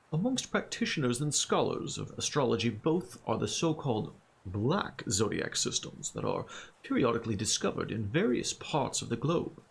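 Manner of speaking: put-on reading in a lofty Middle English accent. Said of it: "Now I adopt my Middle English accent".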